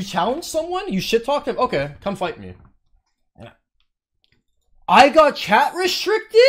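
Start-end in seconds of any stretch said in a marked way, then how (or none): 4.9-6.4 much higher and louder, slow, stunned; outraged disbelief. Real, not acted. Streamer hit with a chat ban, outraged shout.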